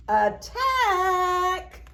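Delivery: loud long drawn battle cry. Acted. Max charging his bowl of crunchies, loud battle cry. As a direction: draw it out like a charge.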